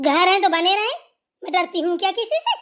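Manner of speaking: loud, defiant retort, laughing through it; defiant shout. Acted. Mother-in-law to bahu about neighbours, loud and defiant. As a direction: throw it back at her, daring.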